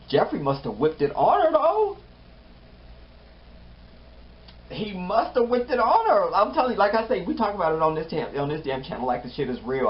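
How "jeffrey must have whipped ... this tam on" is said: excited gossip. Real, not acted. Host gossiping to viewers about Jeffrey, high and loud. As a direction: hype it up as juicy gossip.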